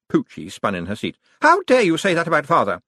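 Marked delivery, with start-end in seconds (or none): 1.4-2.8 indignant. A narrator's reading. Poochie defends her father.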